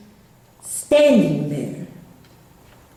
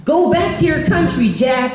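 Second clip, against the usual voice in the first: put-on shouted much higher, like a taunting boy; a boy yelling a slur from a truck window; mock shout; 8 semitones higher than usual. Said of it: "looks at me and shouts".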